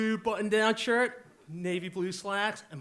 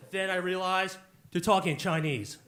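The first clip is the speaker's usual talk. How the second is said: ordinary delivery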